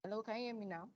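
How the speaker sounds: very soft, faint question; almost too quiet to hear; barely audible. Real, not acted. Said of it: "your voice is very low".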